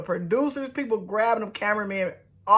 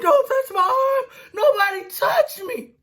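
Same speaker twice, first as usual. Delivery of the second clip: squeaky-high mock scream; voice off, losing it; 4 dB louder than usual. Michael fending off hands in a breakdown, losing it. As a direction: imitate his voice cracking up high, loud. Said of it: "His little voice was off for real".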